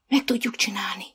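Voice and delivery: breathy whisper with no voice. A narrator's reading. Blind girl whispering encouragement to her horse. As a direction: hush it to the horse alone.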